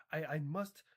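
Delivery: ordinary delivery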